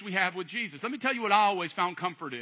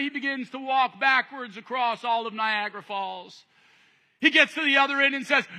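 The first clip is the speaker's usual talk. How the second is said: normal talking voice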